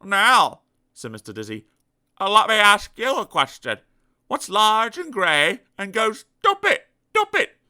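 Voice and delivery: spring the trick again; sly Mister Dizzy. Acted. Mister Dizzy quizzing the elephant, sly.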